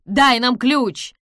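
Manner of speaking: slow loud demand; man demanding the key as water rises; urgent demand. A narrator's reading. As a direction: insist on the key.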